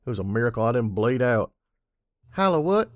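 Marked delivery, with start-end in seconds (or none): 2.3-2.9 high, louder puzzled filly. A narrator's reading. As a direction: ask it baffled like a young filly.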